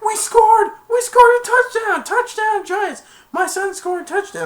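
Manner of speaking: very high shrieking cheers; excited cheering. Retold or imitated. The whole team celebrating, very high joyful screams. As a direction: scream with joy.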